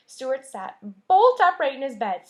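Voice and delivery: plain reading voice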